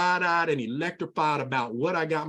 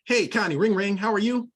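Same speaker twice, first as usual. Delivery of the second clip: upbeat phone call; 5 semitones higher, 4 dB louder than usual. Shown as a demonstration. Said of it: "let's practice this".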